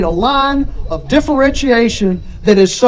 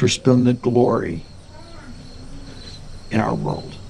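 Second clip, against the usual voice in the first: prayer; 5 semitones lower than usual. Acted. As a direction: lead the congregation in prayer, unhurried. Closing prayer after sermon.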